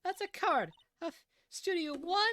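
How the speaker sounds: put-on high old woman voice, huffing between words; huffing old lady. Old lady panting out her story about the boy. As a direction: puff and pant through every phrase.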